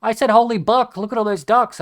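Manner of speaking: mock a child covering up the swear word; innocent kid. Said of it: "they're like".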